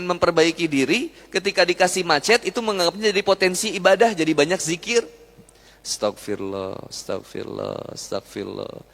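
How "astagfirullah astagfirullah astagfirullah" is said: astagfirullah said low, soft and slow, a calm prayerful murmur; calm dhikr. Retold or imitated. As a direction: murmur astagfirullah gently and slowly, at peace. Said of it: "berubah intonasinya".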